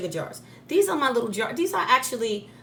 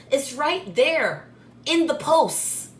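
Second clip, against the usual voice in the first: slow, exasperated; 4 semitones higher, 5 dB louder, much slower than usual. Real, not acted. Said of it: "exasperated emphatic correction".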